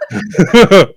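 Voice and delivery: loud, high whoop of triumph; elated cheer. Real, not acted. Player celebrates best comeback ever, elated cheer. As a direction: cheer the roll with laughter in it.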